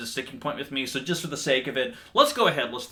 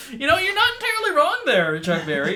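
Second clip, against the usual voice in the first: much higher, louder teasing put-on voice; mock reply; 7 dB louder than usual. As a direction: squeak back a cheeky agreement. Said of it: "I'm like".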